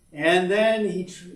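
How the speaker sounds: ordinary delivery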